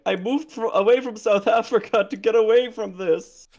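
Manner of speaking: overdramatic quote. Retold or imitated. Friend imitating an overdramatic viral woman from South Africa. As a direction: ham up a dramatic viral complaint. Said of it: "being really weirdly overdramatic".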